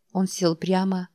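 ordinary delivery, nothing marked

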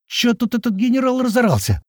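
high-pitched, curious query; puzzled question. A narrator's reading. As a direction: ask what the fuss is. Newcomer's puzzled question about the shouting.

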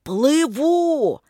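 high, drawn-out distant shout; calling across water. A narrator's reading. Shouted exchange between lake and shore, high long call. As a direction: send the word far over water.

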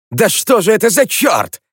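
sharp high indignant cry; outraged, why is this happening; indignant outburst. Acted. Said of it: "Возмутился Эрик".

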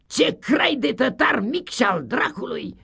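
high, loud, triumphant teacher crowing; loud gloating schoolmaster; gleeful schoolmaster shout. Acted. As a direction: crow it out like a pleased tyrant. Schoolmaster exclaims.

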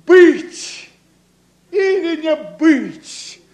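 slow, grand tragic declamation; hammy stage actor. Acted. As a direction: declaim Hamlet like an old tragedian. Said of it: "монолог Гамлета".

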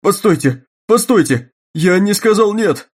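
high, loud panicked outcry; panicked shout. Acted. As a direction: cry out to stop her. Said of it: "выкрикнул он".

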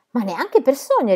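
emphatic comeback; sharp retort. A narrator's reading. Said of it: "ribatté Sop".

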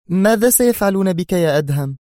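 high, urgent female character voice; sudden question. A narrator's reading. She suddenly asks Adham about his fate, high-pitched. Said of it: "ثم سألته بغتة".

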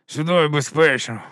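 slow character voice from the mouth's corner; side-of-the-mouth talk. Acted. Corporal reassures the new recruit, talking from the mouth's corner. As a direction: squeeze it out of one side of the mouth. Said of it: "розмовляти кутиком рота".

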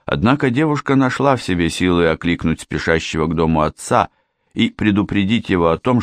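usual speaking voice

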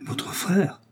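sharp high astonished question; startled high exclamation; surprised cry. A narrator's reading. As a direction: gasp it out in disbelief. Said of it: "s'écria madame Bonacieux".

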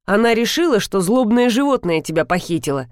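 ordinary delivery, nothing marked

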